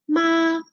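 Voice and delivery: "ma" held long on one level pitch; Mandarin first tone. Shown as a demonstration. Teacher showing the word for mother, level first tone. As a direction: sustain "ma" without rise or fall. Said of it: "porque es primer tono".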